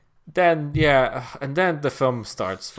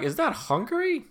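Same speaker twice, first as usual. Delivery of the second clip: sudden much higher disbelieving squeak; baffled question. Retold or imitated. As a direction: ask it in high-pitched, incredulous surprise. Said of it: "I was like".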